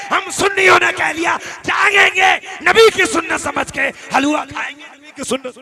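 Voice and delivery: loud, high, rousing sermon shout; impassioned shouted exhortation; full-force preaching. Real, not acted. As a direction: preach it to the back of the crowd.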